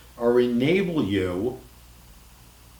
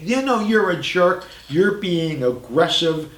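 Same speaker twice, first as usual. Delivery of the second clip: mock accusing; 6 semitones higher than usual. Shown as a demonstration. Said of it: "you're not saying".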